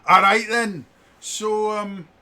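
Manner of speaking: high, loud Cockney; Cockney accent. Acted. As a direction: launch back into broad Cockney. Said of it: "it's a bloody Cockney accent".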